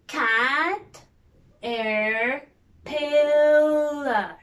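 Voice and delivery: cat-er-pillar, each part apart, last one loud; split into syllables. Shown as a demonstration. Teacher showing kids the syllables, loud. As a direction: break caterpillar into pieces. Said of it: "Let's see".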